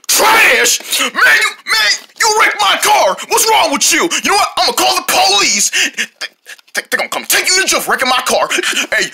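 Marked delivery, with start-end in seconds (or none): 0.1-5.7 angry crash victim. Pretend. Driver shouts at the kid who crashed into his car, outraged. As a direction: yell at the driver who wrecked your car.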